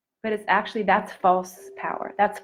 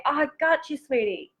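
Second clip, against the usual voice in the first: put-on soft and tender, a touch higher, like comforting a small child; soothing self-talk; 6 semitones higher, 4 dB quieter than usual. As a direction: hold yourself and coo gently. Hugging yourself, soothing inner child.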